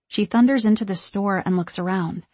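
usual speaking voice

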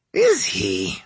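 put-on old woman voice. A narrator's reading. Old lady voice for Hansho.

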